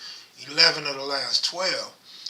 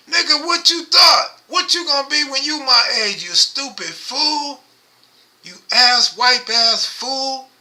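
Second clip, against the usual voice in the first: angry clapback; 8 semitones higher, 6 dB louder than usual. Acted. Clapping back at a troll.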